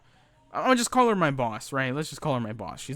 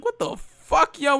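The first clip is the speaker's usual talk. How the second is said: much higher, louder what the fuck; shocked outburst; 12 semitones higher, 6 dB louder, slower than usual. Retold or imitated. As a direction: blurt it out in disbelief. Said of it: "I was like".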